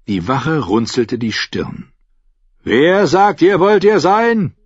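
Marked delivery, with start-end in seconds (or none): 2.6-4.5 higher, louder, dismissive guard voice; gruff guard. Acted. Guard dismisses the knight at the gate. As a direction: brush off the drunk.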